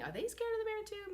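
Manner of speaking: wondering inner voice. Retold or imitated.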